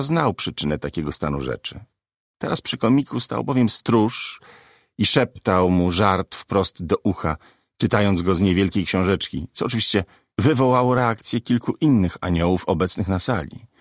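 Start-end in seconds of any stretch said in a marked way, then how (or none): none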